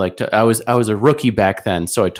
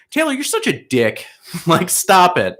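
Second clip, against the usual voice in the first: whiny friend voice; 8 semitones higher than usual. Retold or imitated. Friends scolding drunk Taylor.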